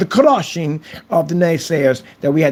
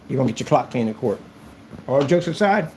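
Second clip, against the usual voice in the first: ordinary delivery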